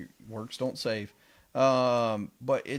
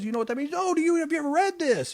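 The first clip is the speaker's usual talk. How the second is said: much higher and louder, a burst of discovery; eager, pitched-up retold exclamation; excited; 4 dB louder, faster than usual. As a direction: retell the man's excitement, voice jumping up high. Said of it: "He's all excited".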